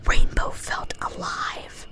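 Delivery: ordinary delivery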